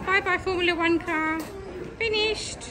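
normal talking voice

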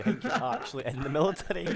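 laughing through the words; giggling as he talks. Real, not acted. Laughing while describing game.

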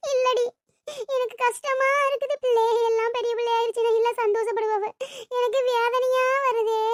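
high tearful wail; crying. Acted. Mother explaining her anguish to relative, crying lament. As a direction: sob out the worry, drawing out the endings. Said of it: "அம்மா ஏன் இப்ப அழுகுது".

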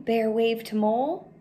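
ordinary delivery

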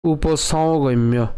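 উপসংগম্য spoken slowly, u-po-sau-gom-yo; উপসংগম্য ধীরে; slow pronunciation demo. Shown as a demonstration. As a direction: draw out উপসংগম্য for learners. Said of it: "কেমন হবে".

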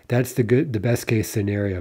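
ordinary talk, nothing marked

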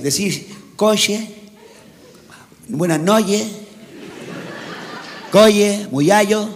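"coche" drawn out slowly as "coye"; mocked Canarian che. Peninsular friend mocking Canarians' speech, "noches" said as "noye". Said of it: "Que la che no la tenéis".

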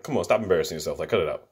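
soft, dismissive aside; unimpressed. Retold or imitated. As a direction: brush it off, unbothered. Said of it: "He's just like".

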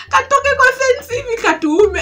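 squealing high through laughter; laughing. Real, not acted. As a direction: giggle the words out. Laughing at her fear.

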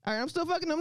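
normal talking voice

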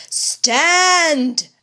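a sudden high, loud stop command; commanding cry. Acted. Old man halting the soldiers with a loud cry. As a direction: halt the soldiers with one ringing word. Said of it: "cried he".

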